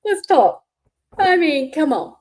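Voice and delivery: high, loud, insistent sugary dare; pushier mock taunt. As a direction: press her to come up, mocking. Taunting Fancy to join live.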